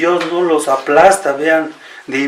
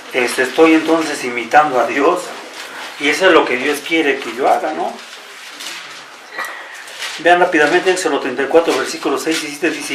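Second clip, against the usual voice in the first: usual speaking voice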